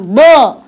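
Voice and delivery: ضاء emphatic, heavy, pushed out; heavy emphatic dad. Shown as a demonstration. Teacher repeats the heavy ضاء with the child, emphatic. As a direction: make ضاء heavy and full. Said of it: "مفخم".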